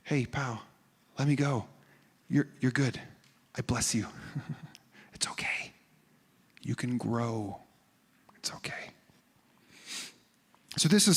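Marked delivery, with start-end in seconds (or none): 0.0-8.9 soft, gentle voice of release; a quiet, reassuring goodbye; tender blessing. Acted. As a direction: reassure them kindly, fading to a whisper. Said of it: "will say to us".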